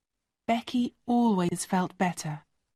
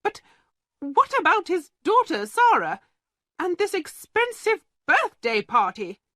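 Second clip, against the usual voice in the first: much higher and louder, panicking about money; alarmed outcry; 10 semitones higher, 3 dB louder than usual. Acted. Headmistress fretting about the unpaid party, high and alarmed. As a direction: she thinks only of her costs. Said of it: "cried Miss Minchin".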